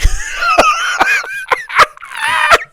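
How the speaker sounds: shrieking laugh of pure disbelief; incredulous laugh. Real, not acted. Coach reacts to a blown play.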